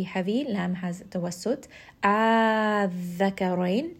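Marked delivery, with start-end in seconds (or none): 2.0-4.0 long held madd on Adh-dhakarain with nabr; six-count madd. Shown as a demonstration. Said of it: "This is going to be a six count med".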